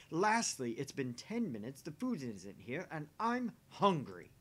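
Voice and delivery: loud, punchy list of grievances; peeved and emphatic; irritated complaint. Acted. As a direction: count off your complaints with loud, drawn-out key words. Acted complaint over dinner.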